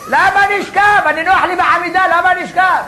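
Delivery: mock whiny husband asking why lie down; whiny protest. Husband protests in sealed room.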